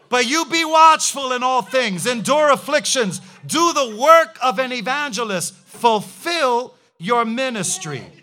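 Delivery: preacher booming Second Timothy at the crowd; preaching at full force. Real, not acted. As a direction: thunder the verse to the congregation. Quotes scripture at full force.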